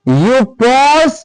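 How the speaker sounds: very slow, drawn-out model sentences; slow dictation. Shown as a demonstration. Teacher giving examples to students, very slowly. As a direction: stretch each word for learners.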